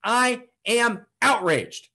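slow, high, word-by-word declaration; drawn-out proclamation of outrage; outraged. Real, not acted. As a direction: hammer out each word with indignation. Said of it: "I've gotta get outraged".

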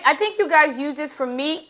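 ordinary talk, nothing marked

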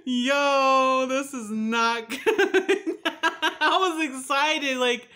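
high, loud hysterical laughter breaking into drawn-out words; laughing in dismay. Real, not acted. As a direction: wail your disappointment through laughter.

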